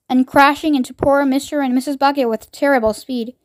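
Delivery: ordinary delivery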